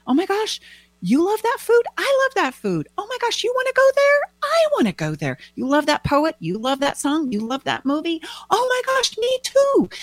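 very high, breakneck enthusiasm; acted giddy gushing, pitch soaring; gushing new-love excitement. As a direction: tumble over yourself finding things in common. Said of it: "we can barely get it out fast enough".

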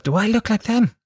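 high, aghast inner voice; mock horrified thought. [name] at the vaccine queue, high mock horror. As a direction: gasp it to yourself in dismay. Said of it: "I thought to myself".

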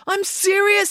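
very high, indignant protest; angry insistence. Acted. April getting angry at being laughed at, insistent.

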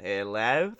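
low mock Australian accent; Australian accent, pitched well down. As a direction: put on a deep Australian hello. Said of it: "Do the Australian accent".

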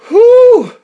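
big loud high exhale; loud high whew. Real, not acted. Vlogger blowing out after his rant, loud high whew. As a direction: blow it out hard.

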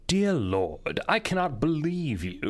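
put-on light posh British accent as Sokolov; a touch of a posh British gentleman, exasperated. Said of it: "this just very posh British".

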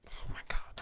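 very soft, defeated whisper; dismayed whisper. Real, not acted. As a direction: fade into a whisper. Gives up on a hard sentence.

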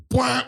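high mock buzzer "wah"; imitated game alarm. Kid hits the meeting button, high imitated alarm. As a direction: blurt a high-pitched alarm noise.

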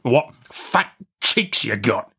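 very slow, relishing convict; convict licking his lips. A narrator's reading. Hungry man eyeing the boy, slow and relishing. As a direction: draw it out as if eyeing a meal. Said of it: "licking his lips".

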